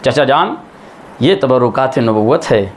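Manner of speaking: normal talking voice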